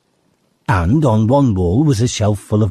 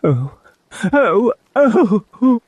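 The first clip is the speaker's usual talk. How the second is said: sobbed; much slower than usual. A narrator's reading. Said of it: "sobbed Mister Tumnus".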